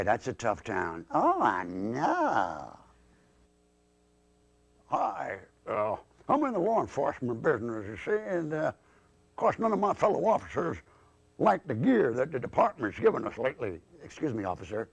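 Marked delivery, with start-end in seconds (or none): 1.1-2.8 slow, swooping Southern drawl; Southern accent. Acted. Southern dummy greets new acquaintance, slow Southern drawl. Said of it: "that accent". 4.9-13.8 lightly voiced puppet police officer; grumbling cop dummy. Cop grumbles about department gear, lightly voiced puppet officer.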